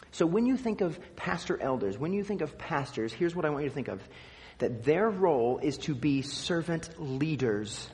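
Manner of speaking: ordinary talk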